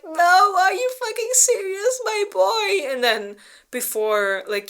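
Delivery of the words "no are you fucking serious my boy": high, loud incredulous squeal; outraged disbelief. Retold or imitated. She protests his choice, a high outraged squeal. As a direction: yelp it out at the boy in shocked disbelief. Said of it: "And she's like".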